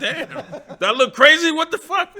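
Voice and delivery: high, slow, laughing through the words; amused outrage, giggling; laughing disbelief. Retold or imitated. Bemused by greetings, laughing.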